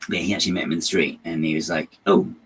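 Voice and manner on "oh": a faint put-on Scottish Doctor voice; terrible Capaldi impression. To a stranger in the street, a bad impression of the actor. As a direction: mimic the actor greeting a grieving fan, badly. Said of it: "that was a terrible impression".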